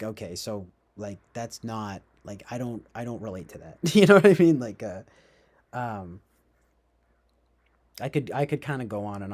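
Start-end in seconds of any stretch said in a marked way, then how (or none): 0.0-3.8 hushed, muttered-to-self thought; low private aside, softer than the rest; quiet inner voice. Retold or imitated. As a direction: say it under your breath, to yourself.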